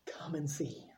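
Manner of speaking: hushed, secret whisper. Retold or imitated. Andrew whispering to his brother Peter to come, in a hushed secret whisper. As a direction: lean in and whisper it. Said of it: "Andrew looks at Peter and says".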